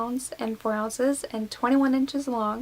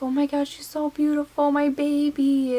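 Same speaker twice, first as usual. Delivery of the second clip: put-on higher, slowing right down on my baby; awed tenderness; 5 semitones higher, 4 dB louder than usual. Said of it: "was like".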